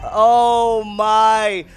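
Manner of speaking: loud drawn-out amazement; amazed exclamation. Real, not acted. Caster reacting to a stunning play, loud and amazed. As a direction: let the amazement out loudly.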